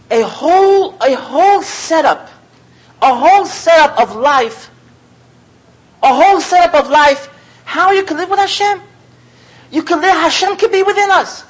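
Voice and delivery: high, loud, insistent sermon voice; impassioned rabbi preaching; fervent preaching. Real, not acted. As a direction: drive the point home with rising passion. Preacher builds to fervent peak.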